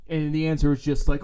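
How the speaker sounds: ordinary delivery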